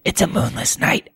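hushed gossip whisper. Pretend. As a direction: breathe it like a secret. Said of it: "I had to whisper it 'cause it's gossip".

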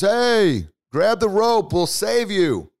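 high, loud shout up close to a yell; calling out. Acted. Helicopter rescuer to man on roof, shouting. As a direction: holler it like a rescuer over distance.

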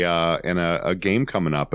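normal talking voice

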